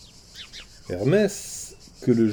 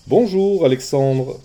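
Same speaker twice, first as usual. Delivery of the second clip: loud, high greeting from afar; calling out; 4 semitones higher, 7 dB louder, slower than usual. Acted. Hermès hails the shepherd, loud and high. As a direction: hail him from down the path. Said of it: "cria celui-ci".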